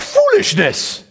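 high, slow comic voice on Foolishness; silly put-on voice. Preacher jokes to the congregation, silly high voice. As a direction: ham it up like a cartoon scold. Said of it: "I just had to throw that in there".

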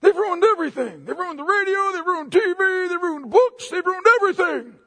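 high, strained mock outrage; mock angry rant. Preacher mocking an angry outburst at the lost, high outraged whine.

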